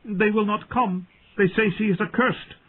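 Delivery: high, tearful handmaid voice; weeping. A narrator's reading. Handmaid refusing the knight's order, weeping. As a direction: protest helplessly through tears. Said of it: "Doreah wept".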